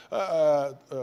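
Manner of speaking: usual speaking voice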